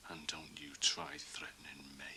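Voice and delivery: a soft, cold whisper of warning; menacing whisper. Acted. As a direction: hiss the warning low and close. Parting threat in a quarrel.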